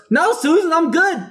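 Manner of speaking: high, breezy mock cashier voice; mimicking Becky. S1 mimics Becky brushing off help, high and breezy. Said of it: "Becky turns around and goes".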